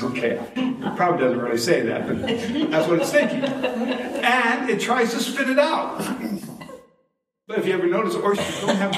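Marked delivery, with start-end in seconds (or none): 2.7-6.0 high, laughing and slowed down. Real, not acted. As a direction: laugh through the words. Laughing high while telling oyster story.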